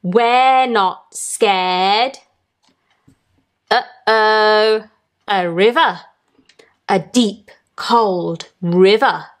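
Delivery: usual speaking voice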